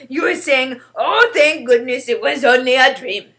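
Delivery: loud, emphatic giant voice; exasperated friendly giant. Acted. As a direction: argue forcefully as a simple-spoken giant. BFG argues.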